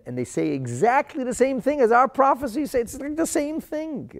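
can barely contain the marvel; thrilled amazement. Real, not acted. Teacher marvelling to students at ancient parchments, thrilled and high. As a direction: pitch leaps way up, voice ringing with wonder.

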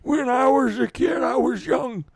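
put-on high, slow, quavering old-timer; very old man. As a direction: ramble like an ancient grandpa. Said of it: "the old man voice".

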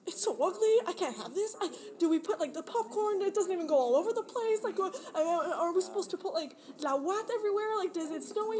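half-hammed panic over an ugly tree; mock panic. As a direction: play your past self freaking out over the tree. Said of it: "I'm like".

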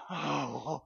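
soft long exhale of relief; relieved sigh. Retold or imitated. Driver relieved the cop only said hi, soft sigh. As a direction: let out the breath you held. Relief after police greeting.

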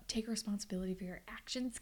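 fast, hushed whisper at Casey; whispered scolding. Pretend. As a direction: whisper the telling-off quickly. Mock scolding of a show character.

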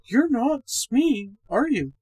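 high, slow woman's question. A narrator's reading. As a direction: raise the voice for a woman. Miss Gorman asks in the dark.

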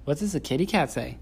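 gentle sing-song prompting. Real, not acted. Parent prompting toddler.

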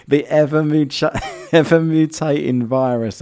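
giggling while reading the phrase; can't get through the words for laughing. Real, not acted.

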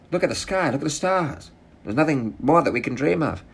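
high, far-off-his-own cartoon speechifying voice; imagined player voice. Comic imagining a free agent announcing his Clippers move, high hammed-up voice. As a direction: pitch it way up, hammed-up old-timey proclaiming. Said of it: "That's how I imagine he talks".

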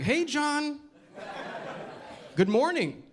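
high, singsong friendly hail; polite neighbour greeting. Acted. Nice neighbour greeting John on the street, polite and high. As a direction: greet him in a put-on cheery high voice.

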